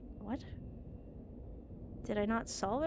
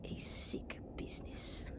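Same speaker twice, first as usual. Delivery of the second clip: slow hushed whisper; whispered aside; 6 dB quieter, slower than usual. Acted. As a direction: breathe it out darkly. Muttered aside.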